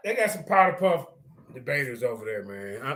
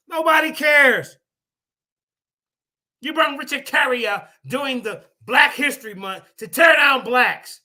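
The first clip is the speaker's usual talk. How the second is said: higher, louder angry yell; angry shouting; 4 semitones higher, 5 dB louder than usual. Real, not acted. Ranting at Richard Carrier's guest, furious shouting. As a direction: blast him with anger.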